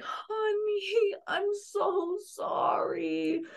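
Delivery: high, soft, very slow and tender; tender comfort. Retold or imitated. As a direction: hold a crying child with your voice. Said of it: "you're crying with them".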